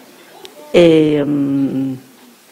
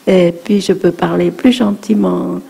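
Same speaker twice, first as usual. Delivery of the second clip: ordinary delivery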